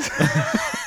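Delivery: short breathy burst of laughter; laughing. Real, not acted. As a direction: crack up at your own joke. Laughs at own Uwe scenario. (kurzes Auflachen)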